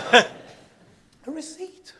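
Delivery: drawn-out amused despair; incredulous laugh. Real, not acted. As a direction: stretch it out, laughing at himself. Repeats the punchline, laughing.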